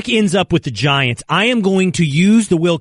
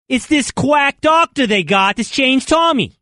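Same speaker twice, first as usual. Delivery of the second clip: hammed-up Boston accent, higher and slow; 9 semitones higher, slower than usual. Host performs the accent for his cohost, mock Boston. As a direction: lay on a thick Boston accent on purpose. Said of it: "How's that?".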